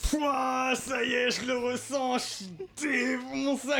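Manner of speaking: high, slow stoner drawl; stoned voice. Shown as a demonstration. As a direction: act totally high again, stretched out. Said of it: "Je peux continuer à faire ça pendant très longtemps".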